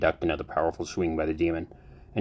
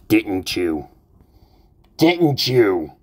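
rising from firm to loud, higher furious demand; heating up with rage; 4 semitones higher, 7 dB louder, much slower than usual. Acted. Captor pressing the cultist to confess, building angry shout. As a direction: build the accusation louder each time. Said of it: "The voice began to heat up".

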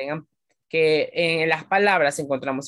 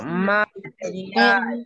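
"material" drawn out very slowly, syllable by syllable; slowly sounded out; much slower than usual. Shown as a demonstration. Teacher sounding out material for pupils, slowly. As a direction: spell out "material" in slow syllables. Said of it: "¿Cuántas sílabas tiene esta palabra?".

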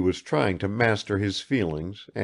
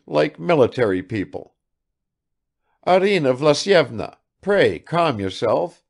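put-on higher and louder old man; fussing, scolding gently; father apologizing then chiding his wife; bluff old soldier; 7 semitones higher, 4 dB louder than usual.

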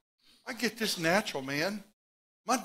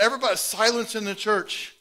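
ordinary talk

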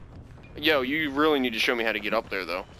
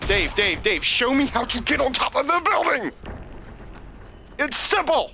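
yelling across the room; 12 semitones higher than usual. Real, not acted. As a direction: shout the demand at your friend. Demanding help from teammate.

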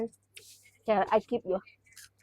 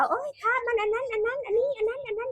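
put-on much higher, whiny kid voice; mock son complaining, higher than her own voice; imitated son; 13 semitones higher, 6 dB quieter than usual. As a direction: whine like a fussy son. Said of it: "ว่า".

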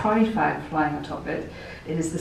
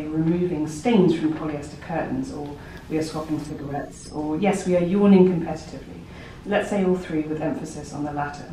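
normal talking voice

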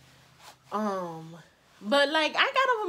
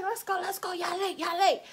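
high-pitched nagging hurry-up; imitated impatient sister; rushing sister; 9 semitones higher than usual. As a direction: chivvy the kids along, pitched high. Sister rushing them to school.

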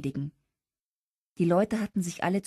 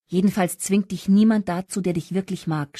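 ordinary delivery, nothing marked

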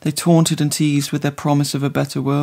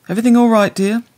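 fussing carer; 7 semitones higher, 6 dB louder, faster than usual. A narrator's reading. Carer returns, fussing sweetly.

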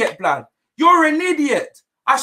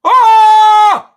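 long, loud, high "oh"; loud roar; 4 semitones higher, 10 dB louder than usual. Real, not acted. Fan amazed by a great ball, loud roar. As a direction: bellow one held cry of amazement.

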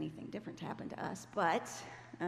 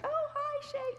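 very high, bright sing-song hello; imitating an old friend's chirpy hello; cheerful sweet greeting; 5 dB louder, slower than usual. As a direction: greet her in a much higher, delighted voice. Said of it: "she's totally different spirit about her".

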